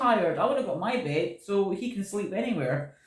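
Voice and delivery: high, loud whiny dog voice; voicing the dog. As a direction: whine like a worn-out pup wanting bed. Voicing the tired guide dog.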